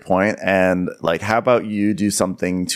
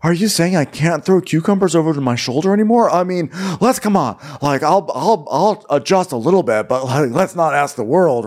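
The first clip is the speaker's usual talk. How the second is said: hammed-up bargaining in a silly voice; mock whiny protest; 8 semitones higher than usual. Mocking the boyfriend's excuses to Taylor, a mild whiny impression.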